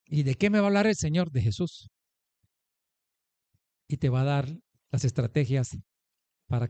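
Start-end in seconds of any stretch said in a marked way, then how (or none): none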